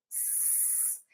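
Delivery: "s" as a long unvoiced hiss, no vowel; phoneme sound. Shown as a demonstration. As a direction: hiss the bare sound "s", no letter name. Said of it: "sapo não começa com sa, começa com". ("s" como som puro, um chiado sem vogal)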